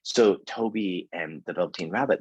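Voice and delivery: ordinary talk, nothing marked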